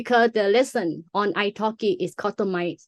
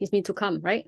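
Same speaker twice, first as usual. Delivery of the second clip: ordinary delivery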